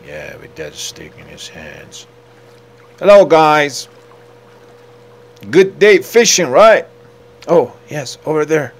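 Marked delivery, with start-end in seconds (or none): 3.0-8.7 loud, high friendly hail; calling out. Pretend. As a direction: hail the fishermen loudly. Calls out to fishermen.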